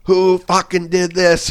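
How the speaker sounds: high, slow demanding drill sergeant. Retold or imitated. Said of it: "he's like".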